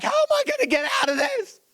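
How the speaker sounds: mock a panicked student; student stuck on math; frustrated cry.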